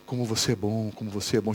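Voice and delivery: tempting devil voice. Acted. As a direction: purr it low like the tempter. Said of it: "Já ouviram essa voz ou não?". (voz baixa e sedutora do diabo)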